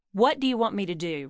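plain reading voice

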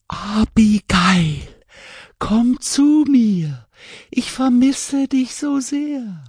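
ghostly whisper. Acted. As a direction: coax like a lonely ghost. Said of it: "flüsterte die Stimme".

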